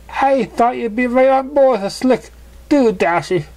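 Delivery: high, bright-spirited tease; teasing pony. Acted. Pony teasing Dashy about her mane, high voice. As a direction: needle her playfully, pitched high.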